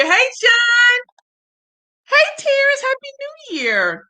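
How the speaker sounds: very high, squealed greeting; excited calling out. Real, not acted. Host calls New Year greetings to arriving viewers, thrilled. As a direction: call out high and thrilled, drawing out the ending.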